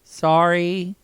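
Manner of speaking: high, loud put-on voice; mock apology. Co-host apologizing to listeners in a high put-on voice. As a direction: squeal the apology cheekily.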